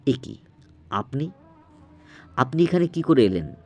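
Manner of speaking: high-pitched, shocked questioning; startled alarm. A narrator's reading. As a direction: question him in frightened disbelief. Suman startled by dead man's appearance.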